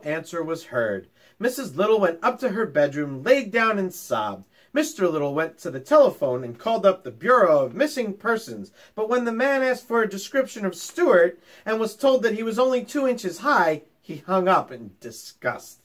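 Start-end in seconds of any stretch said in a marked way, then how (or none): none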